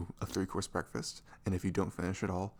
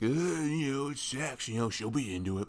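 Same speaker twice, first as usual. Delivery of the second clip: higher, louder dismissive dude voice; careless guy; 5 semitones higher, 5 dB louder than usual. Retold or imitated. Boyfriend mocking careless guys to his girlfriend, dismissive. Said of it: "I know a lot of guys are like".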